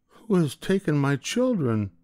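distraught mother; mother finding her children gone, high and pained; crying out. Acted. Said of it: "she cried".

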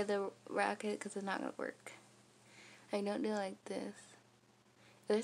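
lip pulled down by finger; talking around finger. Real, not acted. Seen 1: finger presses lower lip showing braces, lowered near 6.6-7.7.

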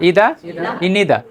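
usual speaking voice

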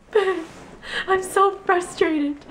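high, slow whimpering complaint; mock whiny cry. Waking boyfriend at night, mock tearful whine. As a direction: imitate her own late-night tears. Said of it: "I wake him, I'm like".

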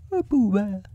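high, simple bear voice; Pooh. Acted. Bear naming himself to the rabbit, high voice. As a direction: state it plainly in high bear voice. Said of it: "said the bear".

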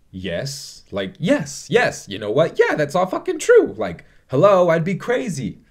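high, loud, over-the-top yes; mock exuberant outburst; acted excited agreement. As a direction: burst into hammed-up, high-pitched agreement. Acts out Alan's excited agreement.

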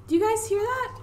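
real surprised question; alarmed question. Real, not acted. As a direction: ask in sudden alarm. Hears water running.

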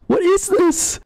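very high, startled question; shocked squeak. Real, not acted. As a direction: squeal in disbelief. Shocked reaction to game.

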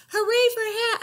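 high, loud happy cheer. Acted. Elephant cheering about the new hat. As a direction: cheer with all joy. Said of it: "Elephant cheered".

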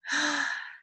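mock-surprised gasp; excited gasp. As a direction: gasp as if spotting a treat. Surprise at pigs for children.

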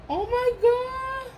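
very high squealed oh my God; thrilled gasp. Real, not acted. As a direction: squeak it out in delight. Thrilled by gifts.

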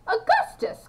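high-pitched cry of greeting; excited, soaring exclamation; delighted cry. A narrator's reading. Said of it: "cried Mister Wonka".

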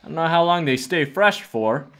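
normal talking voice

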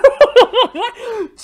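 bursting into loud, high laughter. Real, not acted. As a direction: cracks up out loud. Streamer laughs at the show.

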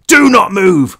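loud, high barked order; police shout. A narrator's reading. Armed cop ordering the kids to freeze, loud shout. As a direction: command them to freeze. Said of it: "one of them shouted".

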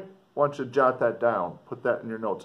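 usual speaking voice

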